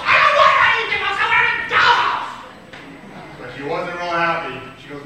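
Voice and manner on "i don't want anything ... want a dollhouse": high-pitched, fast, loud protest; high loud whine. Acted. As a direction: insist like a stubborn kid. Girl refuses alternative.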